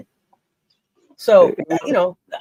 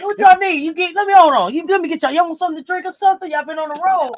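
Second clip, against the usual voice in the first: imitating fussing Coach; 7 semitones higher, faster than usual. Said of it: "He jumped up straight out the".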